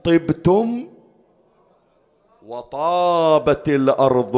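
slow, drawn-out pilgrimage recitation; devotional recitation. Acted. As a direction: recite it solemnly, stretching the long vowels.

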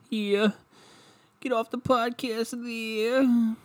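mocking himself in a squeaky high voice; silly high voice. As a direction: stretch it out in a high cartoonish voice.

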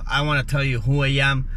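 ordinary talk, nothing marked

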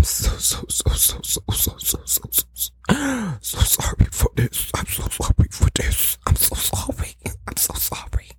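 fading from soft to a breathy whisper; fake-sorry, trailing off to a whisper; mock whispered apology.

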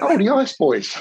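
imitating an officer pleased to catch them; mock delighted recognition. Officer recognises the pirate station.

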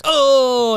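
loud, high mock "ohh" from a crowd; imitated audience groan. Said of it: "it would get grumblings on her".

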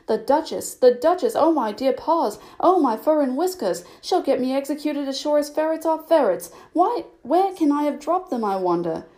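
high-pitched, agitated storybook fretting; fretful muttering. A narrator's reading. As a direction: worry aloud, pitch climbing through the run. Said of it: "she heard it muttering to itself".

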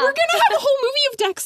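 high, loud, fast excited gush. Real, not acted. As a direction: blurt it out, thrilled. Fans thrilled at movie news.

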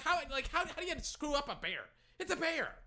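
exasperated, pitched high; incredulous rant. Real, not acted. Fan mocks a botched bear logo, high exasperated disbelief. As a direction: plead the obvious in disbelief.

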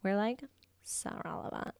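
put-on bored, dragging valley girl voice; female douchebag voice. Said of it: "I haven't whipped out the millennial voice".

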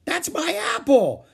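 put-on very high, loud child's scream. A narrator's reading. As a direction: scream it like a grabbed toy. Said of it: "screamed Ramona".

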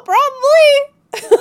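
laughing admission. Real, not acted. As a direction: laugh it out while admitting. Admits through laughter.

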